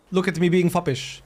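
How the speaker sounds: normal talking voice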